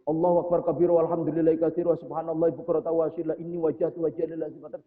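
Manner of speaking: fast hurried recitation; rushed reading. Shown as a demonstration. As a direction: gabble through it to hit a quota. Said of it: "Jadi bacanya buru-buru". (membaca cepat terburu-buru)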